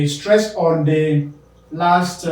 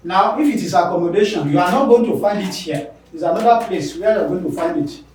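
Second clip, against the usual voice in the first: ordinary talk, nothing marked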